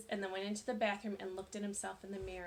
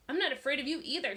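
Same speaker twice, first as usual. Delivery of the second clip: defiant; 5 semitones higher, 6 dB louder, faster than usual. A narrator's reading.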